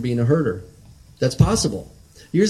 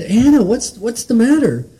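mother calling her crying daughter, higher and louder; wife asks her sobbing girl what's wrong, higher and louder, worried; 9 semitones higher, 4 dB louder than usual. Retold or imitated. Wife asks sobbing Anna what's the matter, higher and louder. Wife's worried question, retold.

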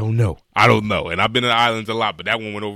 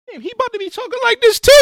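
high, fast put-on voice; high mock parent aside; faster than usual. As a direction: imitate worried parents, pitched way up. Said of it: "looking like".

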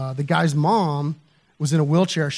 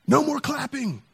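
voice from the darkness; 9 semitones higher than usual. Retold or imitated. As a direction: imitate a neighbor calling out in a high put-on voice. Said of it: "I hear this voice".

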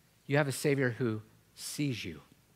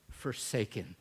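ordinary talk

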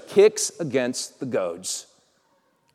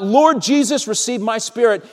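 high, raised-voice plea; calling out; 6 semitones higher than usual. Acted. Stephen being stoned calls to Jesus, calling out. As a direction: cry upward, easing at the end. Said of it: "Stephen called out".

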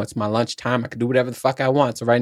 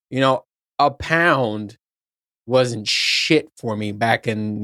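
drawn-out, deliberately slow words; very slowly; much slower than usual. Shown as a demonstration. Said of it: "let's say this very slowly".

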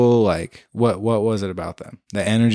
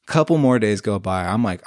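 usual speaking voice

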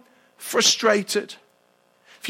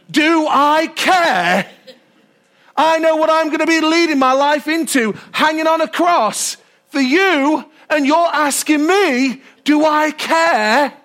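indignant reply; 5 semitones higher than usual. Acted. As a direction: throw the question back, stung and slow. Jesus answers Martha's audacity.

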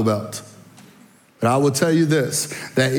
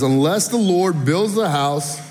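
scripture reading; 5 semitones higher than usual. Shown as a demonstration. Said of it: "Psalms one twenty-seven one says".

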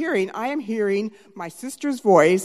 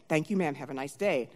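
dismissive officer; 4 semitones lower, 8 dB quieter than usual. Retold or imitated. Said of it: "he said".

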